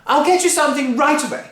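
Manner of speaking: high, loud, apologetic; flustered daughter. Acted. Missus Cranky appeasing Grandma, high flustered apology. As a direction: placate her mother.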